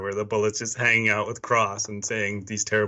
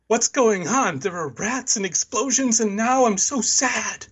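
much higher, bewildered whine; high confused voice; 11 semitones higher than usual. Retold or imitated. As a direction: squeak it out, lost and confused. Body's confused voice.

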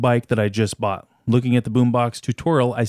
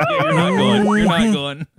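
barked order, higher and louder; drill sergeant; 11 semitones higher, 5 dB louder than usual. Retold or imitated. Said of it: "my drill sergeant ... he goes".

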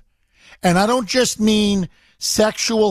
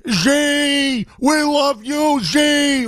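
fawning adoration; 7 semitones higher, much slower than usual. Retold or imitated. Mocking fawning business leaders.

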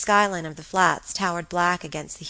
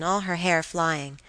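usual speaking voice

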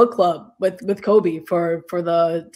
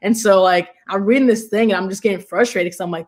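ordinary talk

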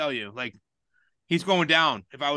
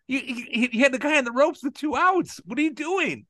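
mock rant; 10 semitones higher than usual. Retelling his rant at the manager, high-pitched and exasperated. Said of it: "I go".

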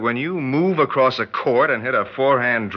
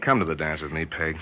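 soft coaxing; 7 semitones lower, 7 dB quieter, faster than usual. Acted. Coaxing her gently.

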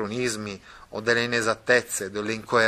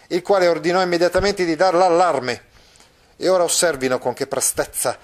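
animated reading, higher and a touch faster; story narration; 4 semitones higher, 4 dB louder than usual. Acted. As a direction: declaims the farewell to the lovers. Reading the alarm scene. (narrazione letta con enfasi)

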